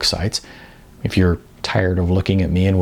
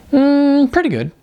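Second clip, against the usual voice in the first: a high, loud hum, then an easy reply; a hint of a perky friend; lightly voiced Jasmine; 4 dB louder than usual. Jasmine telling Icon about her workout, lightly voiced and perky. Jasmine answers after the gym.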